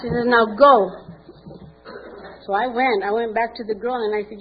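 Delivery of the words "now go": loud firm command; stern order. Retold or imitated. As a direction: send her off with a louder order. Sponsor orders her back.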